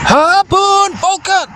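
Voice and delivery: very high, drawn-out battle yell; attack call. Acted. Ikakumon fires its attack with a high, drawn-out cry. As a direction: cry the move name like an anime fighter.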